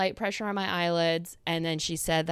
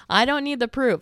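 louder, higher comic insistence; raised-voice mock protest; emphatic protest; 6 semitones higher, 6 dB louder than usual. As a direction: insist loudly, cutting off the offer. Repeats retort more forcefully.

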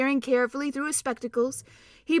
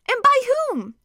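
much higher, loud astonished cry; shocked; 14 semitones higher, 8 dB louder than usual. Acted. King hearing of betrayal, sharp shocked cry. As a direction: leap up in disbelief.

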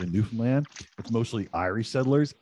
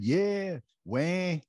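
put-on higher-pitched, playful Québécois accent; Quebec accent; 9 semitones higher than usual. Co-host gives in and imitates a Quebecer, high Quebec accent. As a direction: put on a Quebec French-Canadian twang. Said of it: "can you do that Quebec one".

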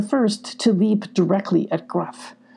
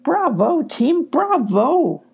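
higher, slow cheer; 8 semitones higher, slower than usual. A narrator's reading. Sylvie cheering her team after the fight. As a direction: celebrate the win. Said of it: "cheered Sylvie".

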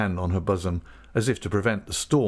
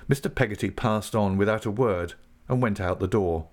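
ordinary delivery, nothing marked

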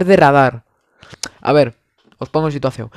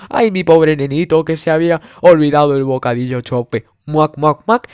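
doting mother. Retold or imitated.

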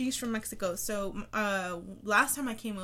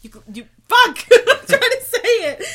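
laughing; 12 semitones higher, 18 dB louder than usual. Real, not acted. Laughing while trying to speak.

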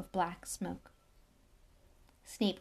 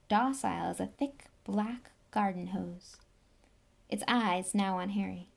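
plain reading voice

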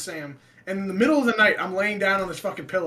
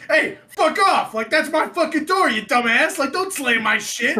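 high, loud mock-furious outburst; indignant shouted rant, pitched way up; angry yelling; 7 semitones higher, 6 dB louder than usual. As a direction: re-enact yelling at a demon. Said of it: "I just started fucking yelling".

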